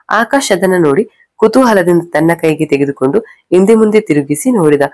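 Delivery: ordinary delivery, nothing marked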